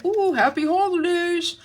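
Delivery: high cartoon moose greeting. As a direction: coo it like a happy cartoon moose. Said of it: "That's my moose voice".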